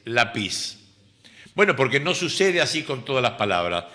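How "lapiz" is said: laPIZ, stress on the last syllable; lapiz misstressed at the end. Shown as a demonstration. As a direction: say lapiz low with final stress. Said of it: "lo normal sería decir".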